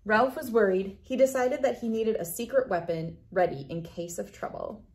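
usual speaking voice

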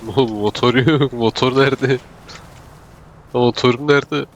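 panicked. Real, not acted. Frantic search for lost motorbike.

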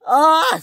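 high, loud scream; scream of fright. Acted. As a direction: scream as you fall. Scruff falls.

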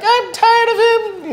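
lightly mocking girl voice; imitating Mina. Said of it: "She's like".

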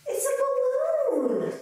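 high, slow amazed reveal; surprised. Acted. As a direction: gasp out the surprise.

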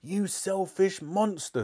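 high, drawn-out outraged cry; screaming anger without full volume; mock scream. A narrator's reading. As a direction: hurl it slowly as the furious mother. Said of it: "screamed Mother".

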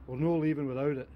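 ordinary talk, nothing marked

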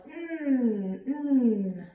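long hummed n sound; letter sound demo. Shown as a demonstration. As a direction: hold "nnn" through the nose. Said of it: "Nigel Night Owl says".

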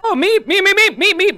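startled meep. Pretend. Excited meep chatter.

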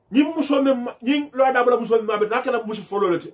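normal talking voice

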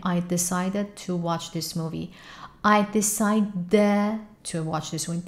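slow "decided to" with its final d dropped; "decided" run straight into "to". Shown as a demonstration. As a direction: swallow the d of "decided". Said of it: "دی آخرش میفته".

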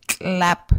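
"clap." with a click out the side of the mouth; "clap." with a lateral tongue click. Shown as a demonstration. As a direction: click the start of "clap." sideways. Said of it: "out the side of your mouth".

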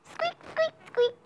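tiny high squeaks of a mouse; squeaky mouse. Acted. Mouse noise in story.

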